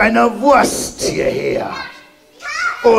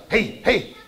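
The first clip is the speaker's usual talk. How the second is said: ordinary delivery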